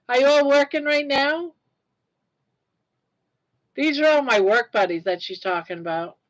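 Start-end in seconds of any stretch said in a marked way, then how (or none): none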